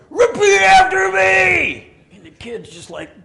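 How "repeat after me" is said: high, drawn-out shout; hyped-up pastor. Acted. Pastor running up behind to rouse kids, wild shout. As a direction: yell it long and high at a crowd. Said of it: "Pastor Reed comes running up behind me".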